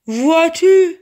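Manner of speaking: big, ringing cavernous voice; cavernous genie voice. A narrator's reading. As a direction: boom it out from inside a bottle. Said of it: "lança une voix caverneuse".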